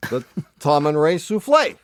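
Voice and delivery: usual speaking voice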